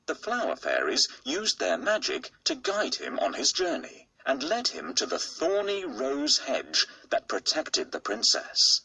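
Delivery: ordinary delivery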